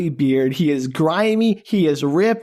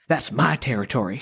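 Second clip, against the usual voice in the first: lower, softer Southern twang, laid-back; McConaughey impression; 5 semitones lower, 4 dB quieter than usual. Podcast host quoting Van Zan in a lower, softer McConaughey Southern twang. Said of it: "I appreciate your best McConaughey".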